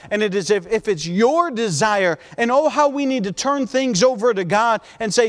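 normal talking voice

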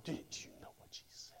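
very soft, scandalized whisper; mock indignant whisper. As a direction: whisper it as an acted-out gripe to a husband. Acts wife complaining to husband.

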